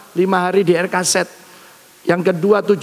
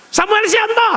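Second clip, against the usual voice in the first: nurse calling; 12 semitones higher than usual. Retold or imitated. Nurse calls out name.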